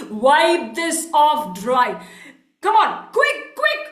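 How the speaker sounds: bossy angry order. Acted. Merchant orders coolie to dry floor, impatiently. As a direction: order it word by word, then hurry him.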